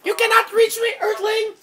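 very high alien taunt; conehead voice. Pretend. Alien telling an earthling they cannot win, conehead voice. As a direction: gloat like a high-pitched conehead. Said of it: "I sound like a conehead".

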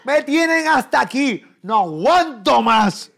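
high, loud, drawn-out exasperation; mock fed-up yell. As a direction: drag out the yelled complaint. Repite el regaño de hartazgo. (grito alargado de hartazgo fingido)

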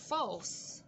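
"False" said alone, falling, with final s; isolated teacher's model of "False"; model for repetition. Shown as a demonstration. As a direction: give "False" carefully for students to copy. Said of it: "bắt chước theo".

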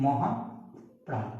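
মহাপ্রাণ stretched very slowly and softly; slow soft teaching demo of মহাপ্রাণ; drawn-out demo. Shown as a demonstration. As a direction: draw out every sound of মহাপ্রাণ. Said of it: "এটাকে বলবো মহাপ্রাণ".

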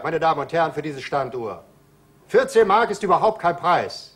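normal talking voice

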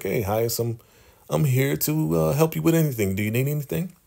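high, sweet-talking eager voice; sly helper. Retold or imitated. River offering the leader help, high fawning voice. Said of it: "He's like".

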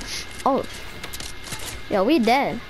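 usual speaking voice

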